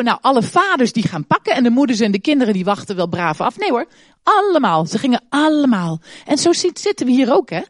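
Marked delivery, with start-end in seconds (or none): none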